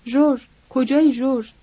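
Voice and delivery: restrained call for someone missing; understated searching cry; mild shout. A narrator's reading. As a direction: call out as if searching, without full volume. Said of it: "فریاد اسلیم شنیده شد".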